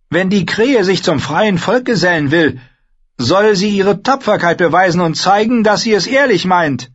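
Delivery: high-pitched, forceful wildling woman; challenging spearwoman. Acted. Spearwoman demands the crow prove his courage, challenging. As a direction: lay down the challenge, high and loud.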